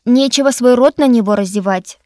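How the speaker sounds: loud, stern scolding; stern rebuke. A narrator's reading. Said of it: "сурово осадила его Дарина".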